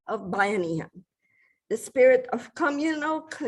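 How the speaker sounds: normal talking voice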